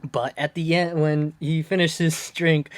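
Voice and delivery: ordinary talk, nothing marked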